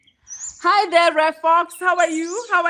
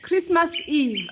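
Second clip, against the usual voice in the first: ordinary talk, nothing marked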